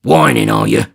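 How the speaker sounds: loud scornful snarl; angry robber, loud; Sikes scolding. Acted. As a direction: bark it at her.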